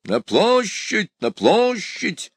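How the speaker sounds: high, long-drawn rallying cry; calling from afar. A narrator's reading. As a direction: call out as if from a distance, stretching vowels. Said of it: "закричал издали император". (протяжный крик издали)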